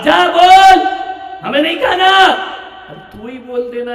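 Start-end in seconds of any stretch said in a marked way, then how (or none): 0.0-2.4 loud, much higher mock-angry huff; sulky father retort. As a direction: snap back like an offended dad.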